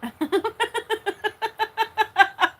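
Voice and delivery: breathless high giggle; helpless high-pitched laugh; high giggling fit. Real, not acted. Chat teasing her.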